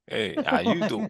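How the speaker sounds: high, flirty "how you doing"; a hammed-up Joey come-on; playful pickup line in a high mock voice; Joey from Friends. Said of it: "pulling a Joey from Friends".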